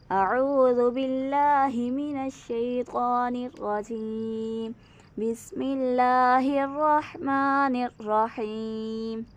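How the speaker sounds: slow, measured Quranic recitation. Acted. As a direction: recite it slowly and reverently. Recites before lesson. (धीमी तिलावत)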